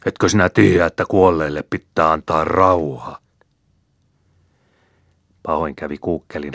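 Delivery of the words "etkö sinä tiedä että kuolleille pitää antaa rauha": raging scolding shout; angry roar. A narrator's reading. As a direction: bellow at him while shaking him by the neck. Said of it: "hyppi ja karjui".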